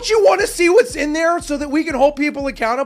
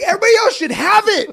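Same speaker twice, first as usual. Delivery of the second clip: retold passionate outburst; 8 semitones higher, 6 dB louder than usual. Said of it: "I was like".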